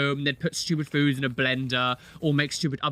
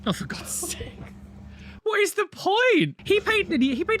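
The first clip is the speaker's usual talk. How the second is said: incredulous. Real, not acted. Watching the barista robot, higher in disbelief. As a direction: stretch it out in disbelief.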